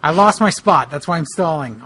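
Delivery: ordinary delivery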